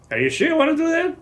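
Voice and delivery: retold colonel surprise; surprised colonel. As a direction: ask it in disbelief. Colonel questioning his choice.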